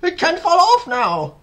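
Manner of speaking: high, loud excited shout; Aunt Sponge shouting. A narrator's reading. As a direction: shout it out triumphantly. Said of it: "Aunt Sponge shouted".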